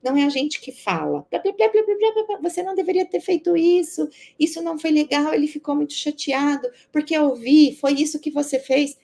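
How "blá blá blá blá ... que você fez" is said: imitando de leve uma professora que dá sermão, mais aguda; faça a professora despejando sermão, blá blá blá. Retold or imitated. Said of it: "dando sermões". (leve imitação de sermão de professora, um pouco mais aguda)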